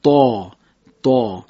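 طا said thick and dark, tongue hollowed; طا درشت; heavy emphatic ta. Shown as a demonstration. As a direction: hit طا heavy and full, twice. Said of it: "حرف طا رو باید ما درشت ادا بکنیم".

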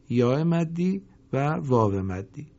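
ordinary talk, nothing marked

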